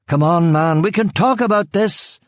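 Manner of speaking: high, shouted threat; yelled. A narrator's reading. Killian threatening the hiding man, high shout. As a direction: yell it out across the garden. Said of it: "Killian yelled".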